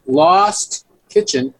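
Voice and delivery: Lost drawn out slow and high; stress on Lost. Shown as a demonstration. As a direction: stretch out the word Lost. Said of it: "L O S T?".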